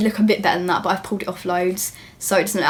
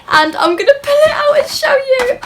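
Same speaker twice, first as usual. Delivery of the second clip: much higher, louder squealing with excitement; excited squeal; 7 dB louder than usual. Real, not acted. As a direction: squeal it like you can't contain yourself. Unboxing her first designer bag.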